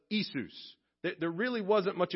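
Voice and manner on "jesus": "Jesus." said Iesu with no J sound, ee-SOO; "Jesus." as Latin Iesus, starting with a vowel. Shown as a demonstration. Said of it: "the Latin translation was".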